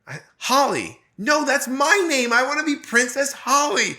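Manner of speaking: put-on a small girl stamping her foot over her name; imitating little daughter. Daughter insisting on being Princess Holly, high child voice. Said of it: "And she goes".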